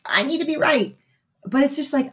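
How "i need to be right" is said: mildly acted, higher-pitched insistence; insistent ego. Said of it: "that's the ego".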